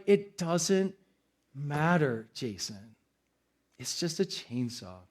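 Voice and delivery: soft, low self-talk; calming himself. Retold or imitated. Pastor calming himself over the lost chainsaw, soft self-talk. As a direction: talk yourself down quietly. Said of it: "I'm literally sitting there like".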